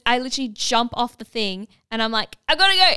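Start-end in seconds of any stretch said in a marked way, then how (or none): 2.5-2.9 high, loud and urgent; desperate dash to the toilet; urgent shout. Retold or imitated. Patient leaping off the scanner shouts loudly and urgently. Rushing to the bathroom.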